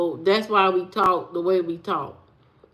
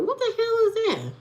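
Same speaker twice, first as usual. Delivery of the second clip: disbelieving; 11 semitones higher, 5 dB quieter than usual. Real, not acted. Reacting to a chat name.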